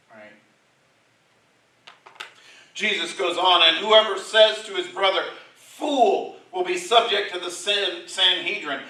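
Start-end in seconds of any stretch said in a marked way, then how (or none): 2.7-8.8 loud, raised preacher's voice quoting scripture; preaching at full force. Acted. Preacher reading Jesus' words to the congregation, booming. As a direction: proclaim it to the back pews.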